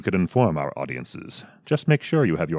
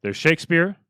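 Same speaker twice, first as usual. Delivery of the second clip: usual speaking voice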